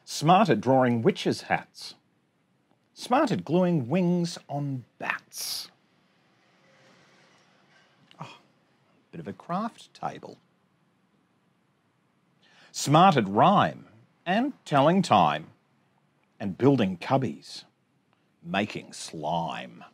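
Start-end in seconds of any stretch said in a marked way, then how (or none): 0.0-5.7 bouncy sing-song rhyme reading; reading to children. Acted. Teacher reading a rhyming picture book to her class, sing-song. 12.7-19.8 bouncy sing-song rhyme reading; reading to children. Acted. Teacher reading a rhyming picture book to her class, sing-song.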